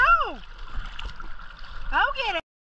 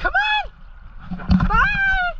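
high squeaky call to the dog; much slower than usual. Real, not acted. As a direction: coax the dog in a high-pitched voice.

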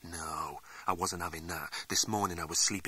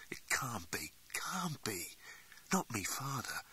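softer whispered denial; shocked whisper; 2 semitones higher, 7 dB quieter than usual. Acted. Man denying the figure is his father, shocked whisper. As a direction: whisper it in disbelief.

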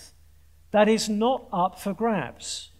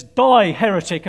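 louder, a comic outburst; the punchline bellowed with fake fury; mock shout; 9 dB louder than usual. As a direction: yell it as a furious zealot. Punchline, man shouts at jumper.

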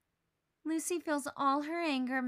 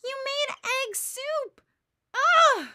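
high, loud disgusted outburst ending in a yelled ugh; 14 semitones higher, 11 dB louder, slower than usual. Acted. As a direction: recoil at the egg soup. Discovering ruined eggs, disgusted.

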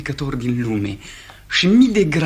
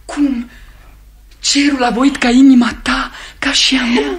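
high, loud surprised exclaim; pitched up in sudden wonder; astonished; 9 semitones higher, 5 dB louder than usual. Acted. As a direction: burst out in amazed delight. Astonished realization.

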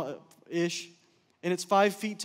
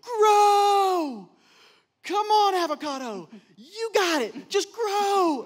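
loud, high cheering yell; yelling at a seed; 12 semitones higher, 7 dB louder, much slower than usual. Retold or imitated. Counselor has Mike yell encouragement at a seed, loud high yell. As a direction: cheer the seed on like a coach at a race. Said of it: "I want you to yell at the avocado seed and go".

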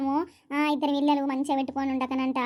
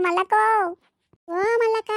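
a higher, louder call across the yard; 5 semitones higher, 4 dB louder than usual. Acted. Wife calls the neighbour again, louder. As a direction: shout her name so she finally hears.

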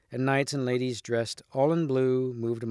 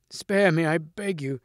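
higher, louder begging; storybook knight's urgent plea; gasped plea; 4 semitones higher, 3 dB louder than usual. A narrator's reading. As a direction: plead for your life, pitched up. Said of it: "gasped the Green Knight".

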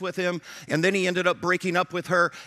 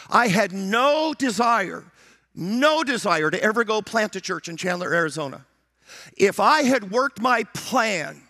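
full-force preaching; 3 semitones higher, 4 dB louder than usual. Acted. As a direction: hammer each word home.

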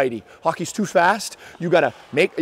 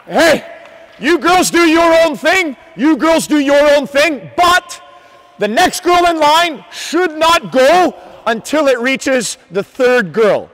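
calling out; 6 semitones higher, 5 dB louder, slower than usual. Real, not acted. Coach calling instructions across ice.

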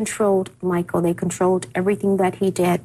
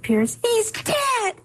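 high, drawn-out cry of the news, a little less loud than a real scream; retold scream; 12 semitones higher, much slower than usual. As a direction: recall the mother's scream, stretched slow and high. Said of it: "she screamed as loud as she could".

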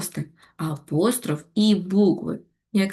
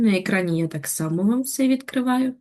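ordinary talk, nothing marked

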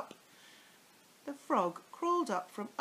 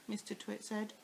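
plain reading voice

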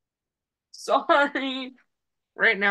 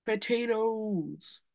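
slow mocking voice; 4 dB quieter, much slower than usual. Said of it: "You were like".

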